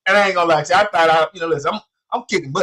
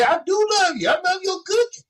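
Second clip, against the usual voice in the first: pleading son; 13 semitones higher than usual. Retold or imitated. Son pleading high with his mom, defensive. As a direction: voice jumps high in protest.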